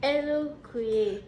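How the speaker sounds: slow and syllable by syllable; staccato. Shown as a demonstration. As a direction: break Elokoye into separate syllables. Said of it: "Say it again".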